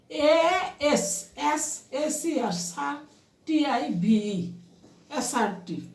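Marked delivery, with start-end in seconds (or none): 0.1-4.5 slow, deliberate letter-by-letter spelling; spelling by syllables. Shown as a demonstration. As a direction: spell it out slowly, pausing after each syllable. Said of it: "syllable ভাগ করে করে তোমরা উচ্চারণ করো". (Syllable ভাগ করে ধীরে বানান)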